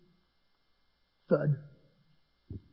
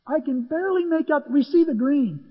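animated; 8 semitones higher than usual. Real, not acted. Peering in the dark.

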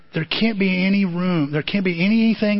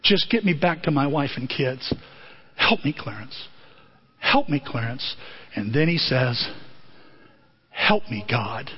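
low, soft, defeated plea; broken prayer; 6 semitones lower, 4 dB quieter than usual. Retold or imitated. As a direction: George begs God at the bridge, low and broken. George broken, praying.

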